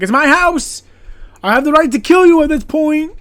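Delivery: shouted high and loud, hammed up; mock outraged yell. Retelling a footballer defending his house, hammed-up outraged yell. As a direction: yell it like you own the place. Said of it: "he's like".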